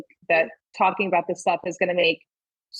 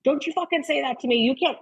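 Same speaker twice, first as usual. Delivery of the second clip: mildly indignant parent, pitched up; mock knee-jerk scolding; 6 semitones higher than usual. Mom reacting to kids yelling back, mock scolding. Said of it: "another knee jerk".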